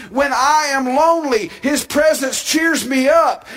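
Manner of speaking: fervent pulpit delivery; preaching. Acted. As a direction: build it like a sermon. Preaching at full force.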